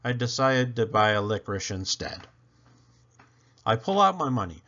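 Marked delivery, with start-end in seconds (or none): none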